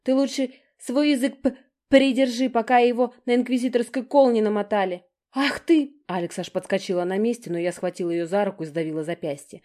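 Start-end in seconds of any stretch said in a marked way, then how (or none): 0.0-5.0 high, loud angry yell; shouting back. A narrator's reading. Younger sister yelling back at Alex, angry shout. 5.3-5.9 high, loud indignant burst; outraged cry. A narrator's reading. Older sister jumping up at her, outraged cry.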